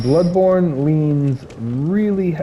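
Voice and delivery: hoarse, voice giving out; sick voice breaking mid-word; voice cracking. Real, not acted. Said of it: "sorry, I'm sick, so I keep losing my voice".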